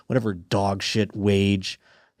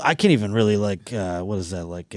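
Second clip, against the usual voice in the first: ordinary talk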